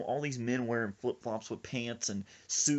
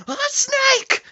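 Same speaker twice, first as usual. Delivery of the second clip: high, very loud panicked shriek; screamed in fright; 16 dB louder than usual. Retold or imitated. Friend spots a garden snake by his feet, screaming. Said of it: "Sir Isaac just screams".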